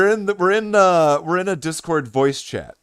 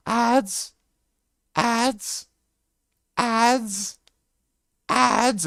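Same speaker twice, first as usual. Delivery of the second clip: high, slow, drawn-out put-on greeting; odd stranger voice; 8 semitones higher, much slower than usual. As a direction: stretch every word, high and strange. Stranger calling for Ads.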